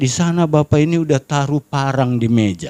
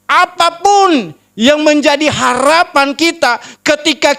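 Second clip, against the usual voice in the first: high-pitched, driving sermon delivery; full-force preaching; 10 semitones higher, 4 dB louder than usual. Real, not acted. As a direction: proclaim it fervently to the congregation. (berkhotbah lantang berapi-api)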